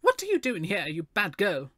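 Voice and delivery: high-pitched scolding mother, kept soft; anxious, angry cry. A narrator's reading. Mother scolds the child she lost, anxious understated anger. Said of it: "cried Miss Weston, anxiety devolving at once into anger".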